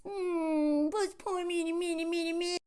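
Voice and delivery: soft, high fake whimper; mock a man crying for sympathy; mock self-pity. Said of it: "'cause when men cry".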